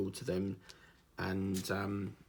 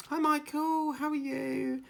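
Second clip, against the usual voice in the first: much higher, sugary mock greeting; imitating a fake-nice carer; 4 dB louder, slower than usual. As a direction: put on a two-faced sweet hello. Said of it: "She'll go".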